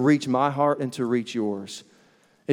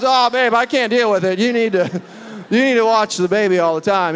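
loud, high-pitched put-on helplessness; feigned overwhelm; 6 semitones higher, 3 dB louder than usual. Husband dodging baby duty with wife, feigned overwhelm. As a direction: plead loudly, pretending you can't cope.

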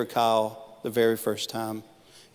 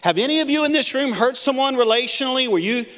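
much higher, louder probing questions; Wesley questioning; 11 semitones higher, 4 dB louder than usual. Retold or imitated. John Wesley grilling his men about money, high and loud. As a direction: grill them like a stern mentor. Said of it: "And they would all answer".

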